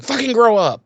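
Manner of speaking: high, loud mock outburst; exasperated scolding. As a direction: snap it out impatiently. Acted impatient scolding.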